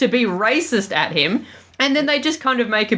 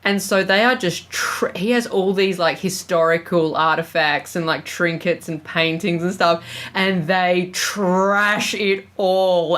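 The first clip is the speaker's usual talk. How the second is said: ordinary delivery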